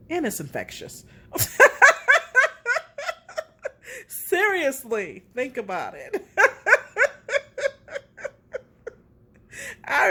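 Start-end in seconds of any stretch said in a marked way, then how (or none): 1.3-4.0 bursts into high, loud laughter. Real, not acted. Laughs after her own remark. 4.1-6.2 laughing while talking. Real, not acted. Talks through laughter. 6.3-8.3 bursts into high, loud laughter. Real, not acted. Laughs again.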